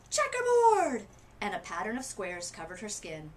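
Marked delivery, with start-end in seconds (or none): 0.1-1.1 high, loud shout. Acted. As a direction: belt it out across the room. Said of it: "Someone else shouted".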